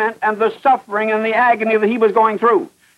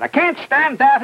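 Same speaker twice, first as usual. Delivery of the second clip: high-pitched retold outburst; mock hysteria, pitched well up; hysterical; 7 semitones higher than usual. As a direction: imitate a broken prisoner, voice jumping high. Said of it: "he was hysterical".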